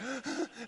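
imitated panting for breath; hyperventilating gasps. Nearly drowned man struggles to talk, imitated gasping. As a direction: mimic someone gasping for air. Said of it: "he's, like, hyperventilating".

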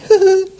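a quick small light chuckle; short chuckle. Shown as a demonstration. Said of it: "Not like".

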